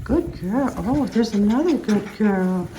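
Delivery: imitating gushing high praise for a dog; high-pitched dog praise. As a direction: coo it like a baby talker to a dog.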